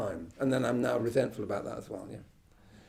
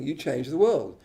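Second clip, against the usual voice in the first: ordinary talk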